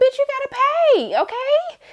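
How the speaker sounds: high, sassy threat; mock vengeful threat. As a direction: voice him demanding payback with attitude. Voicing Michael's vengeance.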